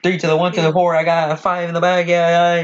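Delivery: high, sustained auctioneer patter; mock auctioneer. Fast talker trying out auctioning for friends, mock auctioneer chant. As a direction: chant bids in a playful auctioneer voice. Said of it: "one of those fucking auction guys".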